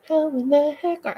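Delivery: high, hollered greeting cut short; exuberant, too loud. Real, not acted. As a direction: start whooping a hello at a friend. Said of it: "I've gotta be quiet".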